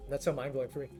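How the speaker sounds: ordinary delivery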